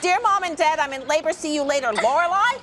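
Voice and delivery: loud, outraged reading-back; loud, incredulous scolding; indignant quoting. Acted. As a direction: throw the note's words back at her. Mother indignantly reads the note back.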